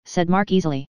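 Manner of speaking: ordinary delivery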